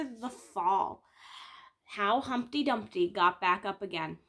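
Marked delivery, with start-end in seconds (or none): none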